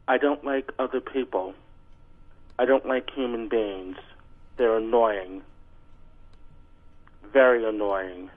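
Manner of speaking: ordinary talk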